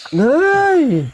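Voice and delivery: high-pitched real fright yelp; startled scream. Real, not acted. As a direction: cry out in sudden shock, stretched high. Jump scare while watching horror.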